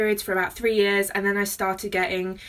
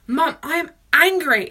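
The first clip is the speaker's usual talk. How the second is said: higher, slow, mock-angry announcement to mum; retold anger; 6 semitones higher, 4 dB louder, slower than usual. Daughter retelling morning mood swings to her mum, retold anger. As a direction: spell out the anger slowly, pitched up, imitating herself. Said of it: "I would wake up so angry".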